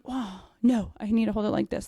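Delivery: ordinary talk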